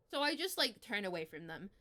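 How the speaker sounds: ordinary talk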